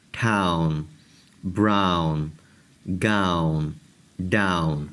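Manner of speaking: ہاؤ and ڈاؤن with a gliding au, as in how and down; each word alone, falling at the end; teacher demonstrating ow words; au sound demo. Shown as a demonstration. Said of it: "جیسے آپ دیکھیں".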